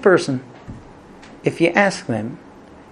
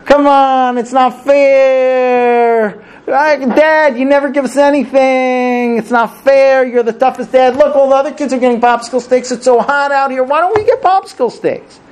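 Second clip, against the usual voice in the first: put-on high, loud whiny child voice, dragged out; whining kids; 8 semitones higher, 5 dB louder than usual. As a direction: kids whining at dad on a hot trip, loud nagging whine. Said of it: "And they start whining".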